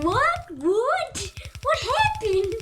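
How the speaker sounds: cartoon character. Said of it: "it was like".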